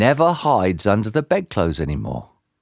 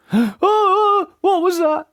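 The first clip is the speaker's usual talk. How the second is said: very high squeaky panicked shriek; much slower than usual. A narrator's reading. Mister Jelly startled by a noise in the grass, shrieking. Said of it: "shrieked Mister Jelly".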